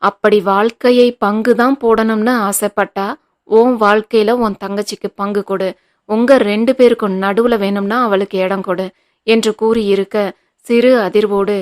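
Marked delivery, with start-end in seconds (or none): none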